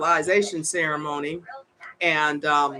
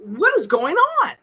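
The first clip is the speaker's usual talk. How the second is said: higher, louder indignant tirade, building; rising outrage to a disbelieving peak; outraged rant; 5 semitones higher, 4 dB louder than usual. Real, not acted. Ranting to viewers about Social Security, building outrage.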